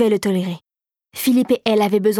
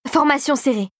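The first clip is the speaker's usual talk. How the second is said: higher-pitched military order; commanding; 4 semitones higher than usual. A narrator's reading. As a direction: order them to close ranks. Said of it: "ordonna Philippe".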